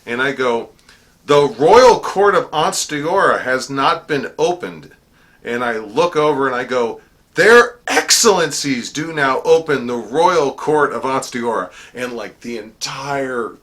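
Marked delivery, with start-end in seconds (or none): 1.2-4.9 high, loud and slow; herald's proclamation. Retold or imitated. Herald announcing the lapse to the court, loud formal proclamation. 7.3-11.7 high, loud and slow; herald's proclamation. Retold or imitated. Herald announcing the lapse to the court, loud formal proclamation.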